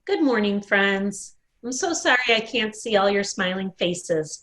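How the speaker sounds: usual speaking voice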